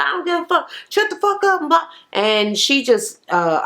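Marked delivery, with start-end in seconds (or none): 0.0-1.9 mock yelling. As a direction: imitate their bickering in a high voice. Retelling yelling at the table.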